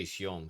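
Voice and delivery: "siong" clipped from bendición, one syllable, shong; casual Dominican "siong". Shown as a demonstration. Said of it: "we say".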